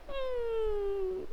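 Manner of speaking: soft, high mock whimper; demonstrated crying. Shown as a demonstration. Teacher shows students the action for crying, mock whimper. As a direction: act out crying with a long high hum. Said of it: "and crying".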